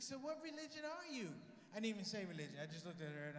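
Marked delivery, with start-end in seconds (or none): 0.0-1.3 high, soft lady voice; prying lady. Retold or imitated. Lady passenger asking his religion, high prying voice. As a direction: imitate a woman probing carefully.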